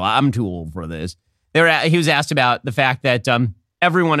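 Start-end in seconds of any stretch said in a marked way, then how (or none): 0.0-1.2 Mitch McConnell. Retold or imitated. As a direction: croak it out like a tired old senator. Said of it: "turtly turtle".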